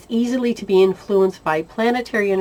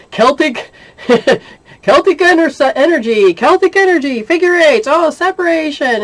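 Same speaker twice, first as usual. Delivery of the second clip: high, loud and laughing; excited; 8 semitones higher, 7 dB louder than usual. Retold or imitated. Doing Celtic energy in her head, a high loud giddy rush. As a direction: rattle it off, giddy.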